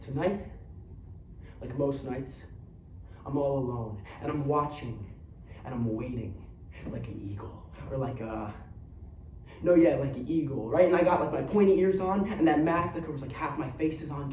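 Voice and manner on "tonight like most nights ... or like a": low, hushed brooding hero; soft, low and solemn; brooding vigil. Acted. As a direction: keep a quiet, solitary night watch, voice dropped low. Brooding night watch scene.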